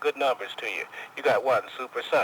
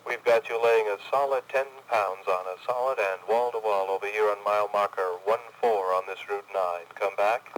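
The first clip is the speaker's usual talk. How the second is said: over cb radio. Acted. Distant CBer answering radio check.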